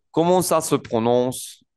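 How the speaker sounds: measured pace, words linked; joined-up model. Shown as a demonstration. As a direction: say the whole French phrase smoothly for learners. Teacher repeats the phrase joined up.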